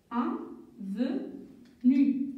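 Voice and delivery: "Avenue" stretched out slowly, syllable by syllable. Shown as a demonstration. Teacher sounds out "Avenue" slowly for the children. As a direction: pull "Avenue" apart slowly for young readers. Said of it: "Je vais écrire".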